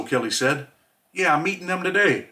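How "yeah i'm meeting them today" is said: mildly glum, higher-pitched reply; gloomy. A narrator's reading. Inmate admitting to his friend he's meeting them today, gloomy. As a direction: answer with a touch of gloom in a raised pitch. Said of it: "I respond in a gloomy voice".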